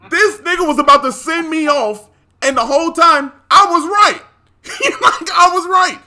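loud, high, laughing indignation; vindicated outburst. Real, not acted. Storyteller telling friend they were right all along, loud and vindicated. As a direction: crow it loudly, pitch way up. Vindicated after the rep's finding.

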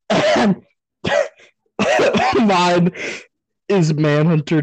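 high, loud, breaking into laughter; barely getting words out laughing; laughing through tears. Real, not acted. Breaking up laughing mid-announcement.

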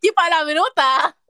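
loud, drawn-out mock shock; escándalo fingido. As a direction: make a big show of being scandalised. Finge escándalo ante la palabrota. (escandalizada en broma, fuerte y alargando)

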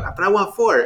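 put-on high childish whine; high mock whine; whiny protest. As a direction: whine it like a kid denied. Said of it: "you're going".

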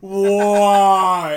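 long, loud drawn-out wail; mock-despairing yell. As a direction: cry out to the heavens, hammed up. Mock despair at being picked.

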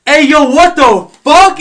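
high, loud, slow yell; a furious high-pitched yell; shouting. Retold or imitated. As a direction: yell it at him, outraged. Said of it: "And Sarah goes".

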